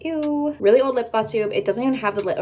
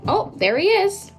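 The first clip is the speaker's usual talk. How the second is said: surprised; 9 semitones higher than usual. Real, not acted. Cat jumps up suddenly.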